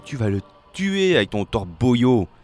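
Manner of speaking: loud, indignant telling-off; loud protest to a reckless drinker; scolding. Acted. Mermaid scolds the sailor.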